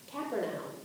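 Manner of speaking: Capernaum said as "KAP-er-now-m"; Capernaum in three syllables, ending like "noun" with an m; own pronunciation. Shown as a demonstration. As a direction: end Capernaum on a "now" sound. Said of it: "I say Capernaum".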